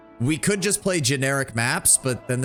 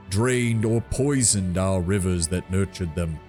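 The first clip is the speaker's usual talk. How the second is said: slow, solemn tree giant; treant voice; much slower than usual. Acted. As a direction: draw each word out like an ancient tree. Treant's oration.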